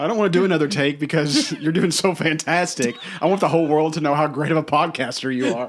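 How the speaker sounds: loud, laughing praise; teasing with laughter. Real, not acted.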